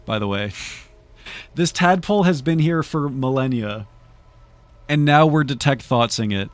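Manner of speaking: normal talking voice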